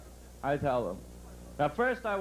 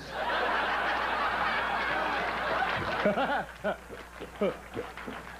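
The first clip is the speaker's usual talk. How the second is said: drawn-out airy laugh at his own punchline; long wheezing laugh; 7 semitones higher than usual. Real, not acted. Comic cracking up at his own punchline. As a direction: let the laugh roll on.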